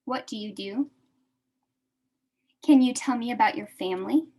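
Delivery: ordinary delivery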